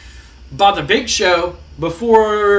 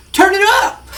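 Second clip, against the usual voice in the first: hype shout; 13 semitones higher, 5 dB louder than usual. Retold or imitated. Entrance catchphrase called out.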